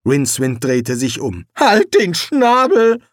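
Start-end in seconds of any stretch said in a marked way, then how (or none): 1.6-3.0 loud, slow, higher snap; annoyed snap. A narrator's reading. Rincewind snapping at the parrot, loud and slow. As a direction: shut the bird up.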